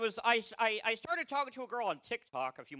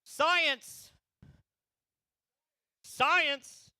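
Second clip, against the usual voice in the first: big high shout of Science!; shouted catchphrase; 9 semitones higher, 7 dB louder than usual. Pretend. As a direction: yell Science! high and loud.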